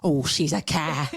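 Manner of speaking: low, slow Cockney put-down; Cockney accent. Acted. Co-host shows the old insult, low slow Cockney. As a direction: drag it out in mock Cockney. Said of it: "Why do you have to say it in".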